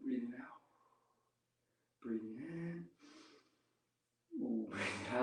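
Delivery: very soft, hushed breathing cues. Real, not acted. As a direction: let the voice drop almost to nothing while holding the balance. Counting breaths while holding a balance.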